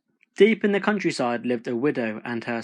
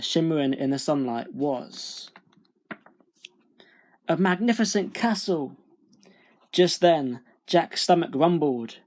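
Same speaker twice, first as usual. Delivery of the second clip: plain reading voice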